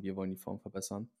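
ordinary talk

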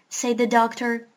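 ordinary delivery, nothing marked

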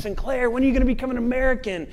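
high, quick imitated questioner; pestering questioner. People keep asking the Scottish theologian, high pestering voice. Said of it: "he's always asked".

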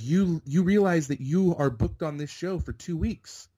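high, punchy imitation of an incredulous extra; emphatic disbelief. Envious extra telling newcomer what he landed, emphatic disbelief. Retold extra spelling out his luck.

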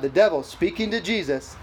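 ordinary delivery

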